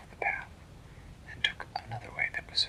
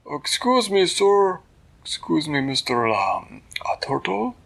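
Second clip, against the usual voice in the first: higher, louder polite calling; polite little voice; 7 semitones higher, 7 dB louder than usual. Acted. Little girl calling up to the turtle, higher and louder. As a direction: call up politely to the giant turtle.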